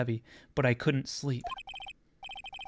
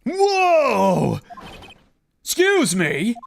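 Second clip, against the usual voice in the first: high, loud startled yell; shocked shout; 9 semitones higher, 10 dB louder, much slower than usual. Acted. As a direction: blurt it out in shock. Detective's shocked outburst.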